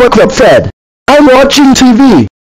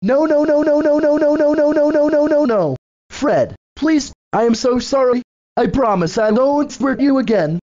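pleading; 11 dB quieter than usual. Acted. Begging Fred not to hurt him.

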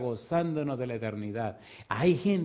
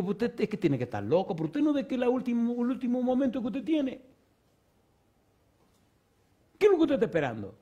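much higher, urgent retold pleading; 11 semitones higher, 3 dB louder than usual. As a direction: push him hard, this is his last moment. Retold pleading with a dying man.

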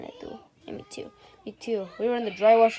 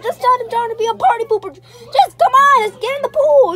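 loud urging; 9 semitones higher, 10 dB louder than usual. Acted. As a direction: drag them in, loudly. Urging friend loudly.

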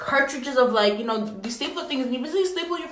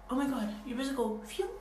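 ordinary talk, nothing marked

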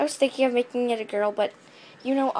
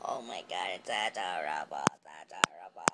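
low cooing; 9 dB quieter than usual. Pretend. Gushing over toy in low voice.

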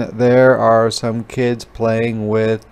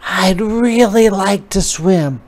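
higher, pleading little voice; pleading spider; 9 semitones higher, 3 dB louder than usual. A narrator's reading. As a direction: ask the frog nicely, hopeful. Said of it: "begged the spider".

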